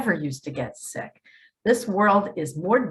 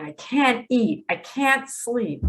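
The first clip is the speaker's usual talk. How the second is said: ordinary delivery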